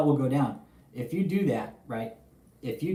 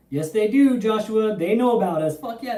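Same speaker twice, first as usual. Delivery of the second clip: high, loud, fired-up yes; real excitement answering a viewer; excited answer; 7 semitones higher, 6 dB louder than usual. Real, not acted. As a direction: burst out with it to the chat. Excited reply to viewer question.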